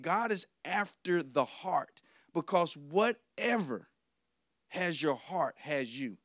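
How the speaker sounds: slow, each word weighed, "whatever" pressed down; preacher repeats the line slowly so it sinks in; slow emphatic repeat. Shown as a demonstration. Preacher restating his key line, slow and emphatic. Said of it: "I want to say that again".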